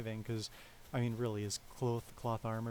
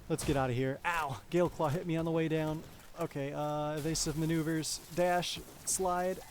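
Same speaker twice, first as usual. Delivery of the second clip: tense, higher and louder callouts; urgent; 4 semitones higher, 4 dB louder than usual. Real, not acted. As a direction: call out the escape moves. Fleeing boss under attack.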